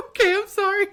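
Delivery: very high, loud sorry; hammed-up high-pitched apologising; acted apology. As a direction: squeak out a mock-sheepish apology. Her acted apology to guard.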